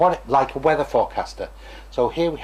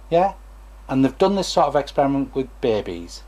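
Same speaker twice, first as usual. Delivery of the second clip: ordinary delivery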